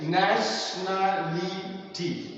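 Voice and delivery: "nationality" slowly broken into separate syllables. Shown as a demonstration. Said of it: "there are more than two syllables".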